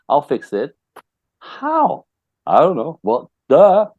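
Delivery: high, incredulous mock dialogue; mock back-and-forth with a dumb husband; mock clueless guy. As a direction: play both sides, squeaky and baffled.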